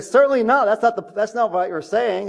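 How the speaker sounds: high-pitched emphatic rebuttal, starting loud; Paul, emphatic. Retold or imitated. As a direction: voice an emphatic objection, pitch jumping up. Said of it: "Very emphatic".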